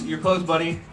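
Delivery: ordinary delivery, nothing marked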